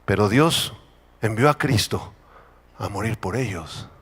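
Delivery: low, solemn drop in voice; quiet pulpit turn, phrases spaced by pauses; hushed preaching. Real, not acted. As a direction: let it fall soft and grave. Hushed turn after fiery denunciation.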